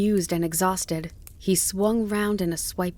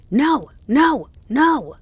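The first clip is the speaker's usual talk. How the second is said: very loud, high yells; yelled refusal; 8 semitones higher, 10 dB louder, slower than usual. A narrator's reading. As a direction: yell each word back. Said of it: "the dog yelled".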